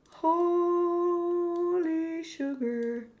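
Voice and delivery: very long, drawn-out first word; stunned. Real, not acted. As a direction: stretch "Holy" out slowly in disbelief.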